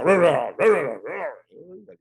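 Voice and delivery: burla del grito. Retold or imitated. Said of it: "te grita".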